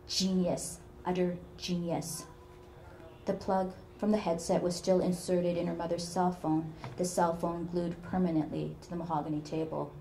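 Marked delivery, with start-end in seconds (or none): none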